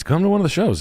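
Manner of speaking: usual speaking voice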